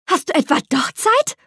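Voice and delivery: bright, high, delighted question; a girl thrilled her friend has time after all; honest joy. A narrator's reading. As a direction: squeal it out with genuine joy. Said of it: "nach einem kurzen Quietschen mit so ehrlicher Freude".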